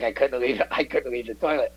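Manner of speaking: laughing through the quote. Retold or imitated. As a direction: laugh while retelling the line. Quoting Dale, laughing.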